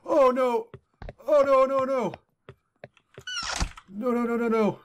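real fright, pleading; panicked cries. Real, not acted. Monster appears in game.

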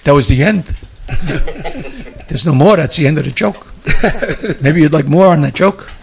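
lightly imitating a comic's flat sign-off; mild low deadpan comic voice; Jackie Mason impression. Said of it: "I can still hear Jackie Mason say".

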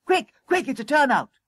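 frantic high-pitched alarm; very high, hurried yell; urgent shouting. A narrator's reading. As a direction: rouse the crew in a panic. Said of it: "he shouted as he raced".

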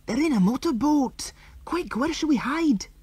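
high, hurried panic; urgent alarm. A narrator's reading. Andy warning the girls, hushed panic. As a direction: push them to hide now.

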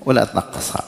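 ordinary delivery

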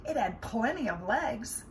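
ordinary delivery, nothing marked

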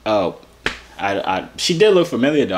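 ordinary talk, nothing marked

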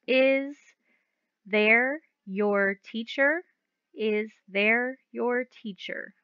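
very slow puzzled question; slow read-aloud. Shown as a demonstration. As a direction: read it slowly to test it. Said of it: "it says".

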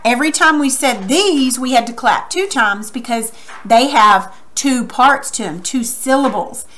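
ordinary delivery

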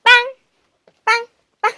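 high loud "bang" calls; loud dog command. Real, not acted. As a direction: shout bang at the dog playfully. Shooting game command to dog.

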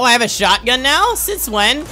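startled, rising question; surprised. Real, not acted. Gamer discovers a new weapon mid-game, surprised.

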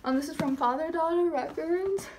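ordinary talk

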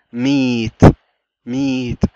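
meat said slowly with a long E; stretched E in meat. Shown as a demonstration. Teacher showing how meat is pronounced, its E stretched slowly. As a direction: draw out the vowel in meat. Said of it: "اتنطقت بشكل ممطوط".

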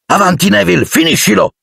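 high-pitched call to finish; urgent urging. A narrator's reading. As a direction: spur the pupil on with a high cry. Teacher urges pupil to finish. (incitamento acuto e pressante)

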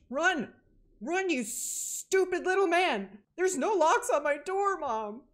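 high, loud panicked yelling; panicked shout. Real, not acted. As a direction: scream at yourself to flee. Fleeing in horror game.

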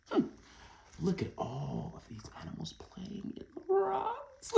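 usual speaking voice

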